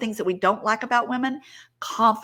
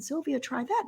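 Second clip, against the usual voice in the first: high, soft imitation of eager sisters; retold coaxing; 5 semitones higher, 7 dB quieter than usual. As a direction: coax lightly in a higher voice.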